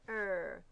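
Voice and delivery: "er" held as one R-coloured vowel; isolated ER sound. Shown as a demonstration. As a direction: sound out "er" on its own. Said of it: "we hear er".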